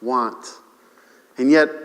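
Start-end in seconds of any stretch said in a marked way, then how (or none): 0.0-0.5 reverent psalm quote. Retold or imitated. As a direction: let the verse settle down. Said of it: "we can almost quote the twenty-third Psalm".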